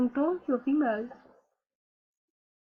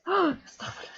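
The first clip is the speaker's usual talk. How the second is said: shocked gasp; 5 semitones higher, much faster than usual. Acted. Shocked at atheist status.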